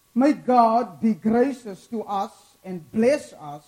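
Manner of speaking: loud, rising scripture reading; dramatic scripture reading. Acted. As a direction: proclaim the psalm to the congregation. Reads the psalm aloud with force.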